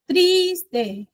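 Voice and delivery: "Triste" split slowly, first syllable high and long; stress on TRIS. Shown as a demonstration. Teacher scanning a trochaic line, stressing the start of "Triste". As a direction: stretch the TRIS of "Triste", let "te" drop. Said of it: "El que más suena fuerte".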